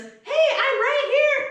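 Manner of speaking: high, loud, drawn-out mock yell; imitating indignant shout. Lady overhears passenger's insult, imitated indignant yell. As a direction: holler it slowly, acting out an overheard woman.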